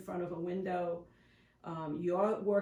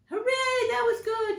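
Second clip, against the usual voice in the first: much higher and louder happy cheer; cheerful praise; 8 dB louder than usual. Shown as a demonstration. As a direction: reward the dog with a big hooray. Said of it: "I love to use the word hooray".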